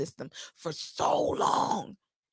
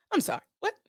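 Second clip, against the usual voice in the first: usual speaking voice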